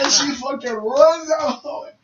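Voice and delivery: ordinary talk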